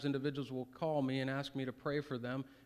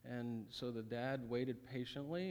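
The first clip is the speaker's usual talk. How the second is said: ordinary delivery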